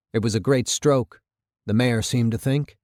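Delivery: ordinary delivery, nothing marked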